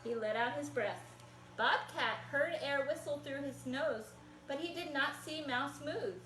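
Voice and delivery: put-on lively picture-book read-aloud for kids; reading to children. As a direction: tell it like a storybook to little listeners.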